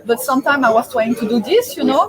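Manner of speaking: normal talking voice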